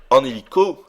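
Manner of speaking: ordinary talk, nothing marked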